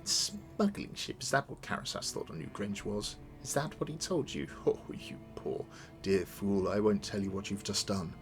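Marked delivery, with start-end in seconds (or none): 0.0-1.2 scoffing. Acted. Villain scoffs at mercenary's claim. 4.6-6.7 slow breathy mocking laugh. Villain mocks captor, laughing.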